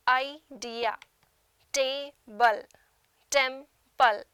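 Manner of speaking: idea, table, temple slowly broken into separate syllables; i-dea, ta-ble, tem-ple, a tiny gap after each syllable; split into syllables. Shown as a demonstration. Teacher showing students two-syllable words, split into syllables. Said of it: "A syllable is a sound that we get in one breath".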